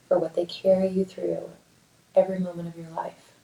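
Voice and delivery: calm, measured meditation voice with long pauses; guided meditation. Acted. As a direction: guide them gently through a body scan, leaving space to breathe. Leads a guided mindfulness meditation.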